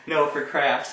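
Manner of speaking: put-on loud, high girl voice; mock teen girl. Teen girl correcting the cart guy, loud and high. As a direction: insist like an annoyed kid. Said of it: "She's like".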